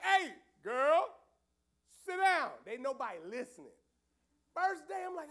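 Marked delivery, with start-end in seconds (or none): 0.0-2.5 loud command, much louder; ordering her to sit. Retold or imitated. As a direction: shout the order across the room. Pastor ordering girl to sit.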